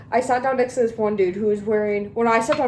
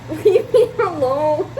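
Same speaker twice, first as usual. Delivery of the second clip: slow laughing plea; mock pleading while giggling; 6 semitones higher, 4 dB louder, slower than usual. Real, not acted. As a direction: laugh through it. Reply to his text.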